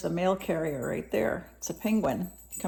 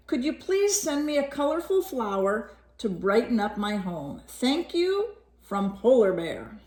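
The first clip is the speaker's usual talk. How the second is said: a hint of a higher, pleading polar bear voice; lightly voiced polar bear; 6 semitones higher than usual. Reading the bear's letter to a child, lightly voiced polar bear. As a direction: read the letter as a gentle bear asking for help.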